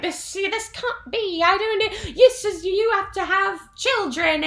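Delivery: high, slow prim royal voice; high, slow mock queen insisting on tradition; stuffy Queen. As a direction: lecture them primly. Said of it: "she's just basically like".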